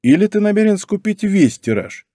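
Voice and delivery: high-pitched ironic incredulity. A narrator's reading. David teases Martin about buying the whole print run, ironically. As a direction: push the pitch up in mocking surprise. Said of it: "иронично спросил Давид".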